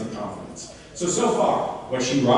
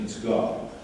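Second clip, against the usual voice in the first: normal talking voice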